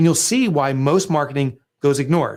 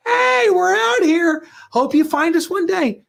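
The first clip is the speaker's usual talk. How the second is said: mock hopeful call-out; slower than usual. Mocking passive small business ads.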